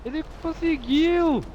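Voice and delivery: high, slow disbelieving shout; amazed cry. Real, not acted. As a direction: marvel at what just happened. Amazed at game moment.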